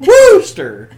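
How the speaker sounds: high, loud wrestler woo in the town name; Ric Flair woo. Pretend. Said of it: "Ric Flair's favorite town".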